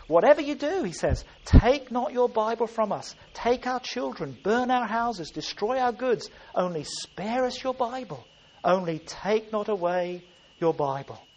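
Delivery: preacher on his knees voicing the desperate people; pleading people crying out. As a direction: beg God not to take the Bible. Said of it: "He's pleading with God. He's crying out".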